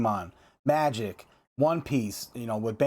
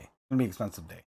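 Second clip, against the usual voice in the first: a dejected low echo of the line; low muttered aside; 8 semitones lower, 5 dB quieter, faster than usual. Real, not acted. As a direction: lower and softer, said to himself. Repeats line, second as aside.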